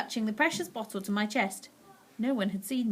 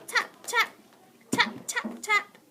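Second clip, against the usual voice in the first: tapping; 12 semitones higher, slower than usual. A narrator's reading. Imitating tapping.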